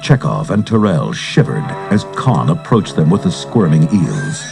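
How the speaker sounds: ordinary talk, nothing marked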